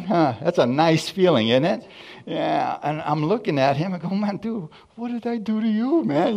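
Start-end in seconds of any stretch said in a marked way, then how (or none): 4.1-6.4 high-pitched aggrieved retelling; mock protest. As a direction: plead innocence in a raised voice. His protest to friend retold.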